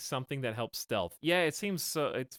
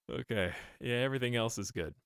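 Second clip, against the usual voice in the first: ordinary talk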